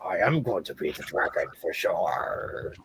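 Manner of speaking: low, lightly growled dragon voice; a hint of a dragon, rumbling low; half dragon voice. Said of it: "my impression of my dragon voice".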